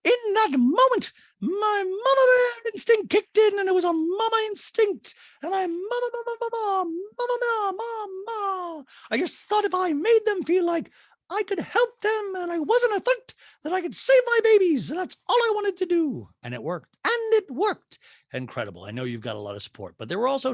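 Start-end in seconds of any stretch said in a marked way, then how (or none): 0.0-16.3 hammed-up squeaky impersonation; Dorit. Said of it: "And Dorit's like". 17.1-18.0 very high mock-dramatic voice; exaggerated squeaky impersonation; Dorit. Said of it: "And Dorit's like".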